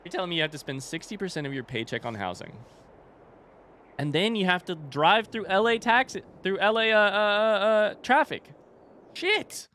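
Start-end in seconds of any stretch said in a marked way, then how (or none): 4.0-9.7 exasperated rant. Real, not acted. Ranting about LA traffic.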